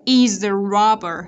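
loud, slow "Is a robber" run together; emphatic model of linked "Is a robber"; linked sounds. Shown as a demonstration. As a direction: carry the z of "Is" into "a". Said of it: "ở đây cô cũng nối âm này".

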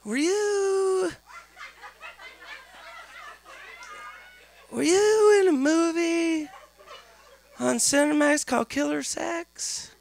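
put-on high, slow, awkward teen; teen nephew voice. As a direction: ask it high and hesitant. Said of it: "comes up to me and says".

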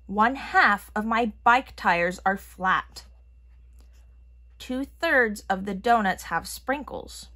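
ordinary delivery

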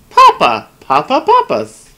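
playful calling out. Pretend. Streamer calling the game dad Papa, playful shout. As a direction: call for Papa like a child.

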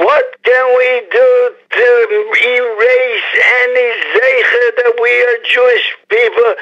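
high, scornful put-on voice; mocking rebel voice. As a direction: sneer it out as the rebels who want Hashem gone. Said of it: "people that are saying to Hashem".

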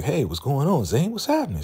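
high, bright mock cheer; happy greeting. River greeting Zane happily, high cheer. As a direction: greet him all happy. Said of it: "River is on some like".

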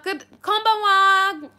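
high, slow, sing-song konbanwa; cheery greeting. Real, not acted. Streamer answering a Japanese greeting, cheery and high. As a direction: greet them brightly in Japanese.

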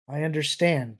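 plain reading voice

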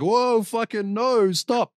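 high, loud, slow "no, stop"; shouting to stop. Retold or imitated. Judge stepping between the drivers, a slow, high, loud shout to stop. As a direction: bark it out to break up the scuffle.

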